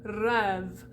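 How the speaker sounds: rêve with the vowel of head; French rêve, open vowel. Shown as a demonstration. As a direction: scoot the "eh" of bed into rêve. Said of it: "say the word head or bed".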